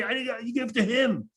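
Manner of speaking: high, strained mock scream. Passenger pointing at the man beside him, mock screaming. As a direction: imitate an accusing passenger in a high voice. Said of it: "He starts screaming and pointing at me".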